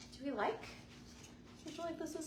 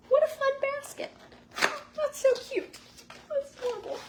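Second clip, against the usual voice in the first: much higher, louder and gushing; delighted; 7 dB louder than usual. Real, not acted. Admiring the gift basket, high delighted gushing. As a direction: coo over the basket.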